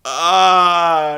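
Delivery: loud mock death roar; bellow, then collapse; hammed-up dying roar; Abyss yell. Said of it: "Abyss is like".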